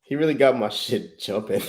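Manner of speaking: usual speaking voice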